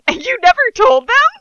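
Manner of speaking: high, loud mock question bursting into giggles; squealed mock-giggly line; suppressed giggles. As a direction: ask it while cracking up, hammed-up. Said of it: "I asked, suppressing giggles".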